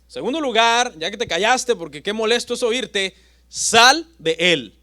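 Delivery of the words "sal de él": loud, slow command; slow, forceful exorcist's command; commanding order. Acted. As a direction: drive the demon out with each word. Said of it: "dos órdenes le da".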